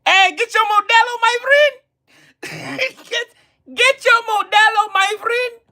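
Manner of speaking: high-pitched put-on Mexican accent. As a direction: call out like a hammed-up Mexican pitchman. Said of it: "talk in my Mexican voice".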